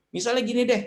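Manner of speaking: normal talking voice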